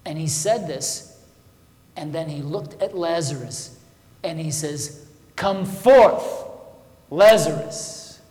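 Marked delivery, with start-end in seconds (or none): 5.3-8.2 booming call, drawn out slowly; loud command. Retold or imitated. Jesus calling Lazarus out of the tomb, booming. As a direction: shout it to raise the dead. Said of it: "with a loud voice".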